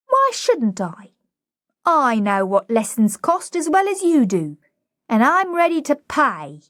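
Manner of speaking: put-on high cockney girl, pleading her case; indignant, high-pitched plea; aggrieved flower girl. Flower girl explaining she wants lessons, aggrieved.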